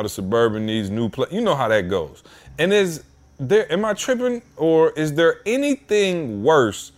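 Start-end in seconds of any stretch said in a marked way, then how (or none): 2.6-6.9 exasperated rant. Real, not acted. Exasperated rant about the DMV.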